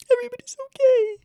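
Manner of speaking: high, fast happy squeal; relieved squeal. Real, not acted.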